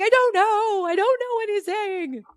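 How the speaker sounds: high, loud mock-distressed wail; mock upset. Stuck on Shakespeare with friends, high upset whine. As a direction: whine it like a frustrated teen. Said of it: "started getting all upset".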